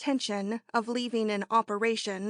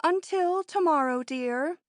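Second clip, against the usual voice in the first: waiting triumph; 7 semitones higher than usual. Acted. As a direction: savour the word tomorrow like a secret win. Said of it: "in a curious tone of waiting triumph".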